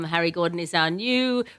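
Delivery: usual speaking voice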